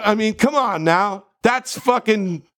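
ordinary talk

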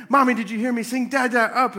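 put-on high, loud kid clamoring for attention; pestering child voice; imitated excited child. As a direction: call out like a little kid interrupting Mommy. Imitating his kids interrupting loudly.

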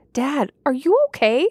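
high, rising frightened questions; near-hysterical worry for her dad; panicked daughter. A narrator's reading. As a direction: daughter seeing her father's wounds, panicking. Daughter sees wounds, panics.

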